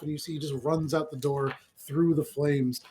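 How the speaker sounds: ordinary talk, nothing marked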